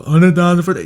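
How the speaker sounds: high, loud slurred rapper voice; mocking mumble rapper; mumbling Future. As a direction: start mumbling the lyric. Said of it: "mock Future mumbling again".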